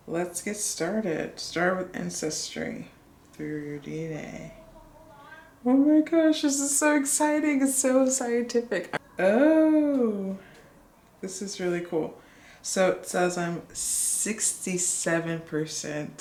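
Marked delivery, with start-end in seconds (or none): 5.6-10.4 high, bright thrill, much higher; higher-pitched and louder, genuinely thrilled; excited. Real, not acted. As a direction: gush over the report. First look at ancestry report.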